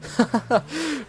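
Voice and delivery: high-pitched mock chuckle; high, amused put-on laugh; imitated mother's laugh. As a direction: act out mom laughing it off. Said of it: "like, laughing about it".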